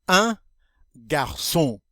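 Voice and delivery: teacher modeling "Un garçon" for the class; model French word. Shown as a demonstration. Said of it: "I'm going to say, um, the word in French".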